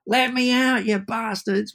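imitating the trapped friend shouting from inside the case; mock scream. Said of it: "and screams".

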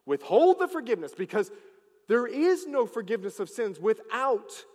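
loud, rising preacher's proclamation; full-force preaching. Acted. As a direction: preach it out to the whole church. Preaching to the congregation.

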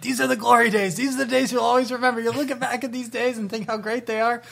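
high, earnest fatherly advice; dad's advice voice. Retold or imitated. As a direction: lift it up like a proud dad. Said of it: "he's like".

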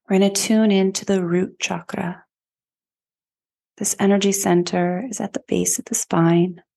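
even, measured meditation voice with long pauses; guided meditation. Acted. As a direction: guide listeners inward, phrase by unhurried phrase. Leads a chakra meditation.

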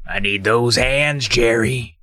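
slow, drawn-out villain line; villain's demand. Acted. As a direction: play a menacing Doctor Shriek, unhurried. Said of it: "but Shriek claims".

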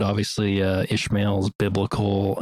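ordinary talk

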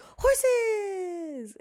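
long, high squealed yell; imitated child's scream. Daughter spots horses while driving, mimicked screaming. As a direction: shriek it like a kid in the car, loud and stretched. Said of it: "and she'd scream it".

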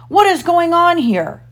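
high, outraged captain's demand; thundering. A narrator's reading. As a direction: demand an explanation in a fury. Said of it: "thundered Hook".

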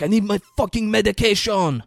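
high, loud imitation of desperation; mock frantic yell. Imitating a sick man demanding his pills, mock frantic yell. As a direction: holler for it in mock agony.

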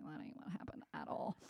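soft, half-whispered mock objection from across the table; husband's muttered correction. Wife imitating husband's muttered correction.

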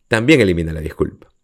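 ordinary talk

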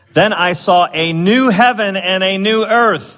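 slow, lifted reading voice; scripture reading. Acted. Preacher reading the verse to his congregation, slow and lifted. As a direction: read the verse out slowly.

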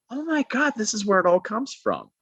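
high-pitched sudden discovery; excited realization. Retold or imitated. Recalling the wedding realization, high excited exclaim. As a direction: blurt it out as if it just clicked. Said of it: "And I'm like".

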